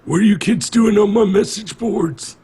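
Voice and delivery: loud mock-angry old man at the kids; cranky old man. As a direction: shout like an outraged old geezer. Mock grumpy old man reply.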